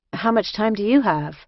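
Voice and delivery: a hint of tentative return question; newcomer asking back. A narrator's reading.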